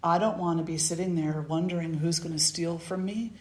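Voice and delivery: usual speaking voice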